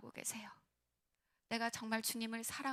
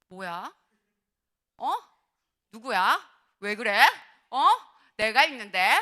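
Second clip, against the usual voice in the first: short, indignant questions, getting louder; mock jealous anger; 16 dB louder than usual. Pastor voicing a girl whose crush is close to another, mock indignant. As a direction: play someone lightly fuming with jealousy. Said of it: "막 열받지".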